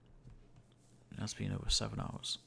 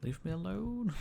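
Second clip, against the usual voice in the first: mock whiny plea; 11 semitones higher than usual. Pleading with a mob.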